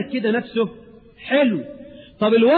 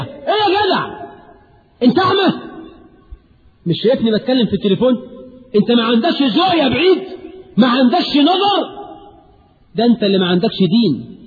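rude angry scolding; 2 semitones higher than usual. Acted. Employee on the phone yells at the man who interrupts, angry rude scolding. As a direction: bark at him like a rude clerk.

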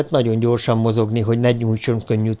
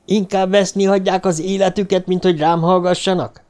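high, indignant shout; shouting back; 7 semitones higher than usual. Acted. Byron shouts at the guards who won't listen, high. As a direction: fling the question at them. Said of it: "kiáltotta Byron".

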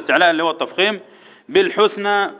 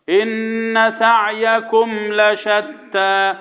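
slow, measured tajweed chant; Quran recitation; 3 semitones higher, much slower than usual. Shown as a demonstration. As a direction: recite it drawn out on a level tone. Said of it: "يبقى نقول ايه على طول".

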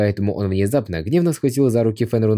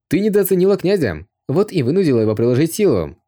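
merry higher call from across the garden; playful teasing holler; cheerful shout; 6 semitones higher than usual. A narrator's reading. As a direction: tease her with a jolly shout. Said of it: "Нью Дао весело крикнул".

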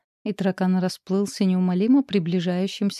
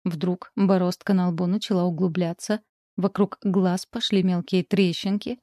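ordinary delivery, nothing marked

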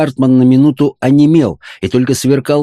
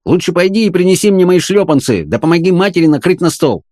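higher-pitched, bossy reprimand; cross scolding; 8 semitones higher than usual. A narrator's reading. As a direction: scold the girl and order her off. Cross man scolding and ordering girl.

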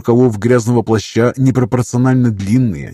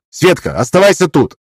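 shouted order; 7 semitones higher, 4 dB louder than usual. A narrator's reading.

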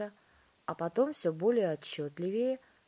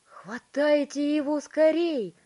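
high, loud urgent order; commanding; 9 semitones higher, 11 dB louder, slower than usual. A narrator's reading. Wonka telling parents to grab the boy, commanding. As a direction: order it forcefully, stretching the words. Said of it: "приказал мистер Вонка".

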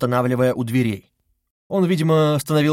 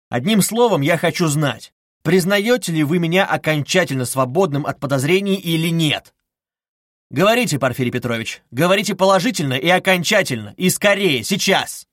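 pressing demand in a raised voice; insistent, loud; 5 semitones higher, 4 dB louder than usual. A narrator's reading. Suspect demanding investigator clear him, loud insistence. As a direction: demand an answer immediately. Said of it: "настойчиво и громко сказал он".

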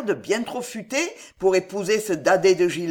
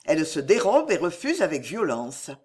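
ordinary delivery

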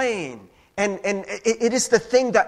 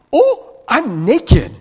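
higher, louder, sudden alarmed yelp; mock startled cry; 5 semitones higher, 12 dB louder, much slower than usual. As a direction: jolt up in mock shock. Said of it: "you're like".

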